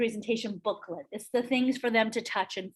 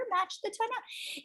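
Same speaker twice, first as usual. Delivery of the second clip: HIGHER and MATCH THE TONALITY lifted high; 9 semitones higher, 8 dB quieter than usual. Shown as a demonstration. Coach showing a client's high tonality, high pitch. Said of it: "If they talk higher".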